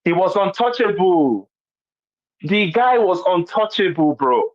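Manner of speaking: untouchable said high and slow; emphatic disbelief. Real, not acted. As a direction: lean hard on untouchable, slowly. Emphatic outrage at impunity.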